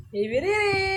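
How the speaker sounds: excited high call. Real, not acted. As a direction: call her name brightly. Higher call. (masiglang mataas na tawag)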